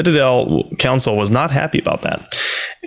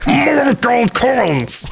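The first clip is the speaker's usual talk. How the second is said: high, very slow alien creature voice; Vorcha voice; 12 semitones higher, much slower than usual. Pretend. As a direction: stretch it out high and goofy. Vorcha economist demanding money.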